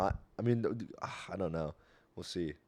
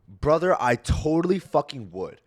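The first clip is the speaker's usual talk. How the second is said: starts raised and climbs to a full yell, higher and louder, slowing at the end; ranting at a friend, rising to a shout; building angry rant; 6 semitones higher, 13 dB louder, slower than usual. Retold or imitated. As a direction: build from firm to yelling about overpriced coffee. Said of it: "That might have been really loud in your ears".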